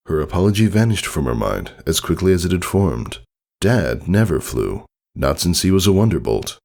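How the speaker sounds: ordinary delivery, nothing marked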